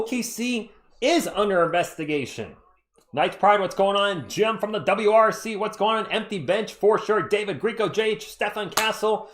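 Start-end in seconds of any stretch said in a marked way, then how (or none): none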